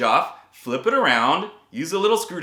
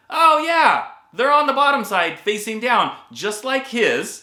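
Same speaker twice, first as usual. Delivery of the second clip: surprised realization; 6 semitones higher, 4 dB louder than usual. Retold or imitated. Realizing the bridge was backwards.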